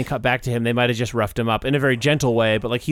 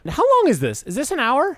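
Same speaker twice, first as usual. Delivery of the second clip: high, exasperated complaint; 12 semitones higher than usual. Real, not acted. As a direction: whine about the length.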